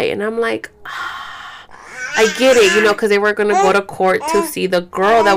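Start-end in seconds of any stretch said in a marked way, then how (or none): 0.8-1.7 voiceless breathy sigh; soft exhaled "ah" in reaction; retold sigh. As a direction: let out a soft, wordless breath. Retold wordless reaction to mother's words.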